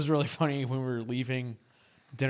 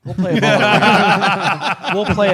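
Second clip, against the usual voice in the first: laughing through the words; 8 semitones higher, 12 dB louder than usual. Real, not acted. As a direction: say it while cracking up. Laughing joke.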